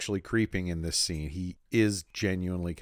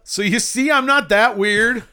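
mock indignant; 14 semitones higher, 11 dB louder than usual. Joking about resembling the creepy guy, loud mock protest. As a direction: proclaim it in hammed-up defense.

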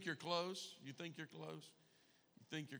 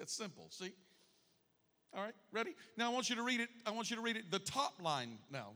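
ordinary talk

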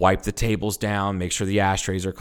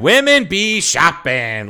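high, stretched-out stand-up punchline; hack comic; 7 semitones higher, 5 dB louder, slower than usual. Acted. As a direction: drag out a corny punchline like a bad comic. Mimicking a hack stand-up comic's bit.

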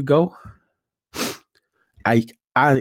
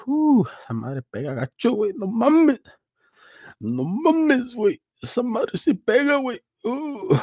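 strained coughing voice; 14 semitones higher than usual. Real, not acted. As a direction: voice squeezed high from coughing. Coughing fit after covid.

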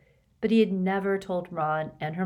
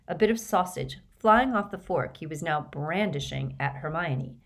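plain reading voice

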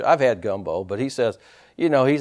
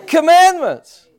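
high, loud preacher's call; punchy, emphatic pulpit exhortation; full-force preaching; 11 semitones higher, 7 dB louder, slower than usual. Acted. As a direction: drive each phrase home to the congregation.

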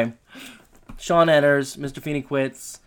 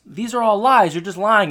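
louder, higher retold outrage; mock accusation; 6 semitones higher, 6 dB louder than usual. Candidate accusing his rival in debate, mock outrage. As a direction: throw it as a playful imitation of an accuser.

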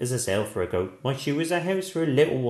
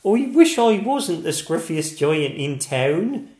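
sadly; 6 semitones higher, 8 dB louder than usual. A narrator's reading. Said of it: "he said sadly".

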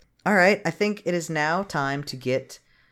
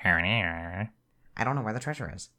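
flat Jim voice; 7 semitones lower, 6 dB quieter than usual. Retold or imitated. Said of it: "everything he says is like".

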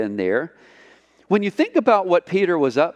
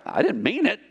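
sheepish Peter; 5 semitones higher than usual. Acted. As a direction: take it back with a nervous laugh. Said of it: "And Peter's like".

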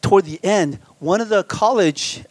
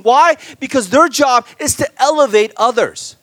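higher, louder preaching build; full-force preaching; 8 semitones higher, 6 dB louder than usual. Acted. Preacher to congregation, on humility, preaching at full force. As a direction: drive the point home like a preacher at full force.

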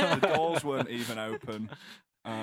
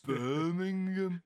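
put-on Brummy accent; 5 semitones higher, much slower than usual. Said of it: "I can't do Brummy voices very well".

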